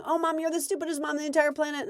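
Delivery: fast, high mock kid outburst; yelling kid. Kid yelling at mom over homework, mock high rant. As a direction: toss off a hammed-up tantrum. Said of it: "start yelling at you and saying".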